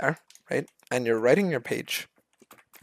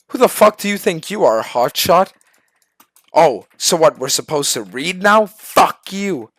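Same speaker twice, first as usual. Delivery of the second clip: loud, higher, hostile retort; mock angry teammate; 4 semitones higher, 6 dB louder than usual. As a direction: bark back like an offended bully. Teammate snaps back, hostile quote.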